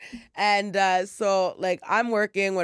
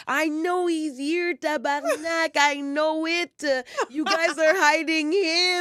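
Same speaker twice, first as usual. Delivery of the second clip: high, slow mock Québécois accusation; Québécois French-English outburst; 8 semitones higher, slower than usual. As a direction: stretch it out as an irate Québécoise. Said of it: "back and forth from French to English".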